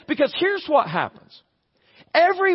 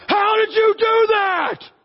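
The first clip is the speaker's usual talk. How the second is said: high, drawn-out angry yell; yelling at kid; 10 semitones higher, slower than usual. Acted. Stressed parent over a child's spilled milk, acted yelling. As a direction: blow up, stretching every word high. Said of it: "yell at our kids".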